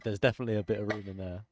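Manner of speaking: loud and fast into the mic; shouted. Shown as a demonstration. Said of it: "I shouted that this time".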